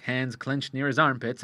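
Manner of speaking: plain reading voice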